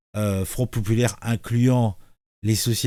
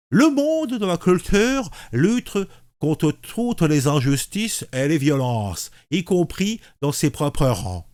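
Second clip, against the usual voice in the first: pinch the mouth and read slowly; lips pursed; 3 semitones higher than usual. Shown as a demonstration. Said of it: "la bouche en cul de poule".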